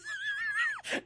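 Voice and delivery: very high screaming cackle of disbelief; real howl of laughter; shrieking laugh. Real, not acted. Caller's answer cracks him up.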